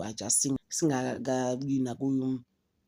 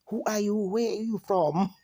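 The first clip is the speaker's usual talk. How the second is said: incredulous challenge; 6 semitones higher than usual. Acted. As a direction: question them like a baffled onlooker. Acted bewildered challenge to Serge.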